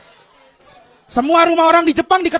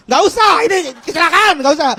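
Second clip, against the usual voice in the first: loud irritated reply; annoyed snap; 6 dB louder than usual. Acted. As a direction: brush him off loudly.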